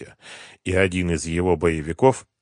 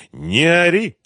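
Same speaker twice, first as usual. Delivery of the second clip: plain reading voice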